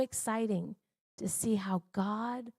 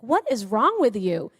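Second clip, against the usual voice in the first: raised, incredulous question; retold exasperated husband; 9 dB louder than usual. Husband asks his wife what's wrong, loud and baffled. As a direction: demand to know what's wrong, loudly.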